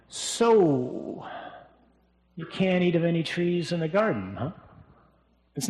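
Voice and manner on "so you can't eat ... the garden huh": fish slyly for a response. Shown as a demonstration. Serpent fishing for Eve's response, sly and leading. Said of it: "It's really more like".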